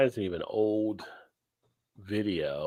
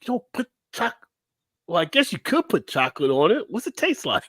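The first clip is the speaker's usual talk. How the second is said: high baffled voice; 8 semitones higher than usual. Retold or imitated. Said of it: "It's like".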